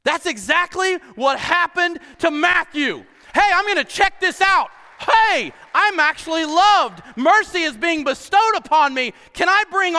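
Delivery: loud, high preacher's cry; full-force preaching. Real, not acted. Pastor to his church, preaching loud and excited. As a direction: shout it out with joy.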